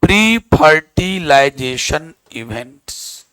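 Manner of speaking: heading dictated slowly, word by word; slow dictation. Real, not acted. Teacher dictating a heading to write. (धीरे-धीरे बोलकर लिखवाना)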